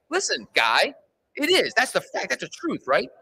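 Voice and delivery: high-pitched indignant arguing, retold; retold protest, pitch jumping up; offended insistence. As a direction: insist like you've been affronted. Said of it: "Now I'm offe-, now I'm offended".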